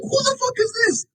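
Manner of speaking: very high incredulous question. Real, not acted. Fan baffled by Megatron's pick, squeaky disbelief.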